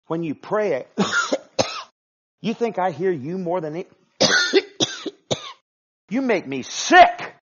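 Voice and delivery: God rebuking the proud, loud and disgusted. Acted. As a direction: let God spit out his disgust. Preacher voices disgusted God.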